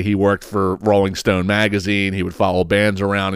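usual speaking voice

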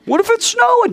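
high, loud what-if; questioning student. Acted. Student pressing the teacher, high loud question.

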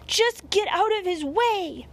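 high, loud yell of warning; urgent cry. A narrator's reading. Dog sending the bunny out of the cat's way, crying out. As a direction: yell it in alarm. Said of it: "I cried".

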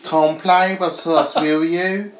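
slow, high, loud mock-British invitation; creepy toy with a British accent, slowly. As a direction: put on a drawn-out British voice. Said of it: "I don't know why they're British".

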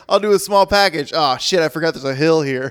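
high, loud imitated Undertaker; mock Undertaker voice. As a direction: play him up high and loud. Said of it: "Undertaker's like".

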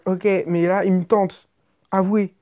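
normal talking voice

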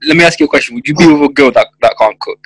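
normal talking voice

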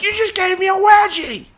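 high, loud outraged cry; imitated screaming. Wife accusing husband over a wedgie, imitated screaming. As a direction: mimic an outraged wife yelling. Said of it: "she's screaming at me".

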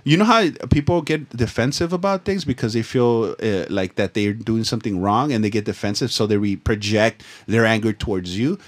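ordinary delivery

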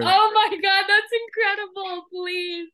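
delighted over the gift; thrilled. Real, not acted.

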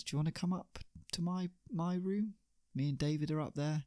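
high, soft, quick come-on; sleazy invitation. Acted. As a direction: slip the offer in softly and fast. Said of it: "he says".

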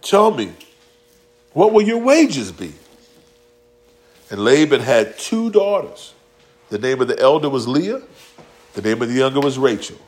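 usual speaking voice

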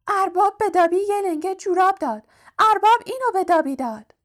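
high-pitched elf voice full of amazement; astonished house-elf. A narrator's reading. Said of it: "جن خانگی با حیرت گفت".

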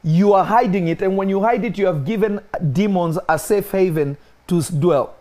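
high, loud, rising sermon; fiery preaching. Acted. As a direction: preach it with mounting fire. Preaching builds to full force.

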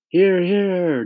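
high, loud cheer of agreement; crowd shouting. A narrator's reading. All the creatures agreeing with the ladybug, shouted high. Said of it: "they all shouted".

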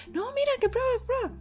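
very high pushy coaxing; insisting host. Retold or imitated. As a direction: urge her to taste, very high. Anfitrión insiste, muy aguda. (anfitrión insiste, voz muy aguda)

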